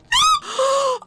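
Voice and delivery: sharp high gasp; loud gasp. Real, not acted. Player startled mid-fight, loud gasp. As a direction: gasp as if caught off guard.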